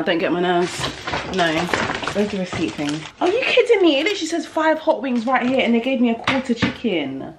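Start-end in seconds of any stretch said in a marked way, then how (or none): 3.2-5.6 indignant. Real, not acted. Finding a quarter chicken instead of wings, indignant outburst. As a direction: let the disbelief burst out. Wrong Nando's order received.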